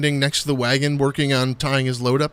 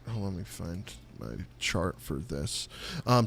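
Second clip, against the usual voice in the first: ordinary delivery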